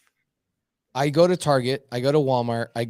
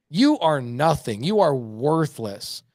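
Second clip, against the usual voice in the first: each word laid down slowly and flatly; slow blunt verdict; much slower than usual. Retold or imitated. Telling a friend his ego is nothing, slow and blunt. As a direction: spell out worthlessness, slow and deliberate.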